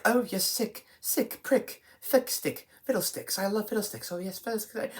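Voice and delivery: high, hushed mock character gibberish; unintelligible babble impression. Reviewer mimicking the actress nobody can understand, soft high babbling. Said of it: "can't understand a word she's fucking saying".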